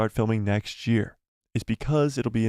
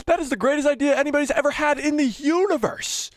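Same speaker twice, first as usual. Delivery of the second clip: far higher, louder, hammed-up rapture; Kathleen Kennedy ecstatic; 14 semitones higher, 3 dB louder than usual. Studio boss hearing her favourite pitch, hammed-up ecstatic. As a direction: gush over the idea like it's genius. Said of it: "my Kathleen Kennedy impression".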